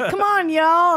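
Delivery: loud, high mock Southern accent. Visitor down south hollering like locals, Southern accent. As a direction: call it out in Southern accent, laughing. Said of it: "when I go down south".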